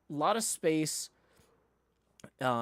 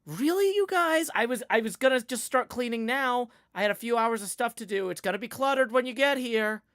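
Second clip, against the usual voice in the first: higher, louder whiny protest; exasperated; 8 semitones higher, 5 dB louder than usual. Retold or imitated. Daughter protesting to parents about early arrival, exasperated. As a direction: playfully complain to the parents. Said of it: "and I'll tell them".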